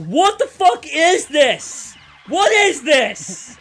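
retold scream. Telling the co-host how she yelled at HDMI cables, mock yell. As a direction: re-enact yelling at the cables. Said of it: "I was, like, screaming".

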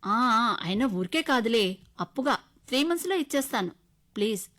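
pleading. Acted. Husband begging his wife for money, loud coaxing plea. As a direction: beg her to call her father. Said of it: "అంటూ ప్రాధేయపడ్డాడు".

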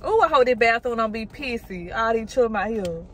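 usual speaking voice

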